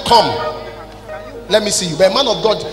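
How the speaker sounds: usual speaking voice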